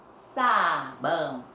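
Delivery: "Sabão" stretched very slowly, syllable by syllable; drawn-out syllables. Shown as a demonstration. Teacher sounding out the S for pupils, very slowly. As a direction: hold the first syllable of "Sabão". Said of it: "S tem som de Z".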